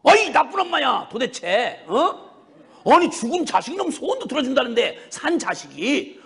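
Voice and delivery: exasperated son telling off his mother; indignant, pleading rant; acted scolding. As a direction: reenact a heated, nagging reproach. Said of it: "막 야단을 치면".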